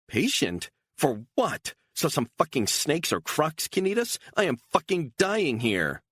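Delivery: she's had enough, near breaking; desperate shout. Acted. Beatrice breaks down in anger.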